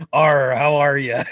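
lightly voiced pirate with a slow arr; slow, mild mock-pirate hello; pirate greeting. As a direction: greet him with a hint of pirate. Greets tour pirate in pirate talk.

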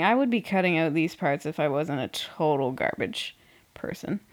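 ordinary delivery

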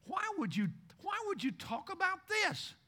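incredulous complaint. Retold or imitated. Preacher retelling his own complaint during a sermon, incredulous. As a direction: whine it in disbelief. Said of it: "I was sitting there saying".

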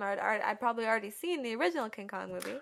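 ordinary talk

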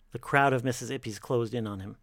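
ordinary delivery

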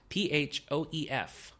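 spelled letter by letter, P H O E F; spelling it out. Shown as a demonstration. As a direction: read out each letter of P H O E F slowly. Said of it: "I'm just gonna spell it for you".

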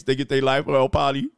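usual speaking voice

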